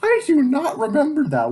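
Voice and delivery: can't believe what you wrote; real shock breaking into a shriek; stunned disbelief. Real, not acted. Shocked by own childhood book.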